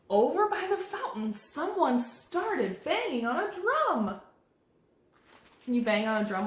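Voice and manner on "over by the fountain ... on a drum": high, drawn-out storytime narration; picture-book voice, slowing at the end; reading to children. A narrator's reading. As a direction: read it with building anticipation.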